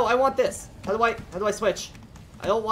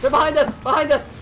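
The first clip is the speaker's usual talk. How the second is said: loud, higher alarm call; urgent warning shout; 4 semitones higher, 5 dB louder than usual. Real, not acted. Player warns team of attack behind, urgent shout. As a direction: shout to warn your team.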